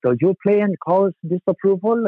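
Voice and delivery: a German caller asking if you're in the band; German-sounding guy. Retold or imitated. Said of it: "this German-sounding guy".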